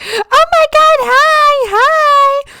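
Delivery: very high, loud squeaky dog greeting; excited dog voice. Retold or imitated. Voicing her excited dog at the door, squeaky and high. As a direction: voice the dog bursting with joy. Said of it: "He was like".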